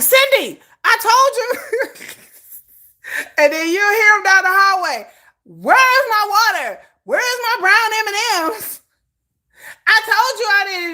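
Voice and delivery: high, loud, demanding; mock diva yell. Star yelling down the hallway at Cindy, loud and demanding. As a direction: bark at the assistant like a spoiled star. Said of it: "they be like".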